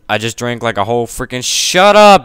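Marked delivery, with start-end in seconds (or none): 1.4-2.3 sharp yell, high and loud; shouting. Real, not acted. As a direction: yell it at someone. Yells at someone in the room.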